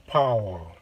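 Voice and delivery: thick-toned American "파워"; American pronunciation. Shown as a demonstration. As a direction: open the mouth wide for a fuller American "파워". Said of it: "세 번째 거가 조금 더 굵은 음으로".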